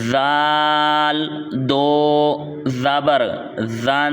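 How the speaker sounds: slow drawn-out spelling of ذال دو زبر زن; spelling-out demo. Shown as a demonstration. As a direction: stretch ذال and دو, then join them into زن without an added alif. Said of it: "ہم نے اس کو یوں پڑھنا ہے".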